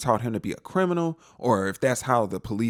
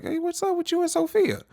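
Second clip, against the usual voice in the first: prying Harry. Retold or imitated. Harry questioning Dexter.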